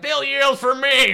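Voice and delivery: high, loud, drawn-out cry; mock defiant shout. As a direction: bellow it high like a cartoon boast. Acting out whiskey yield, bragging.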